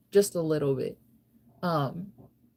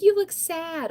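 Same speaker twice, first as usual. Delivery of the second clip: high, louder fake-pity voice; mock concerned people; 12 semitones higher, 5 dB louder, slower than usual. Mocking strangers who say she looks sad, high fake pity. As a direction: pity her in a high put-on voice. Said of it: "Or like".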